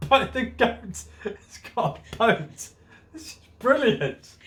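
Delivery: real giggles breaking through; laughing. Real, not acted. Reader cracking up over the goat in a boat, laughing. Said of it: "why I'm laughing".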